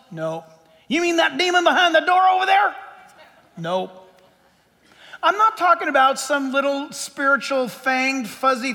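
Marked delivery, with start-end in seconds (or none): 0.9-2.8 higher, louder mock-spooked question; spooked listener. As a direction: point at the door like a jumpy listener.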